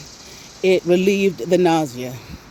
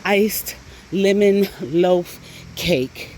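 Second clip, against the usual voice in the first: "iced lemon loaf cake" drawn out very slowly; super emphasizing; much slower than usual. Retold or imitated. Insisting to the boyfriend, slow and emphatic. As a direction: spell it out slowly, every word leaned on. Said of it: "I'm, like, super emphasizing this".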